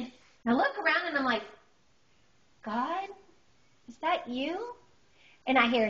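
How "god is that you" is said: soft, hesitant, higher question; hushed, acted wonder; tentative asking. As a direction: ask the unseen voice timidly. Timidly asks the unseen voice.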